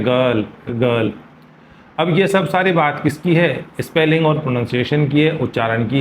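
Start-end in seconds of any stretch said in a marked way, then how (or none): 0.0-1.1 गर्ल with a faint r, "gurl"; correct girl. Shown as a demonstration. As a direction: soften the r in गर्ल. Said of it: "सही pronunciation".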